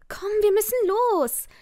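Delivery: high, eager push to hurry; Amy bouncing, pressing her friend to leave; urging. Acted. Amy urges her friend to hurry, high and eager. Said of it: "drang Amy".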